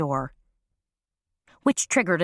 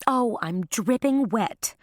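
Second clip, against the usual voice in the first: higher and slow, full of distress; anguished tone; 6 semitones higher, slower than usual. A narrator's reading. Dripping Baby apologizing to Carmela, higher and slow with anguish. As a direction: let the anguish drag the words out. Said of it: "Baby said in an anguished tone".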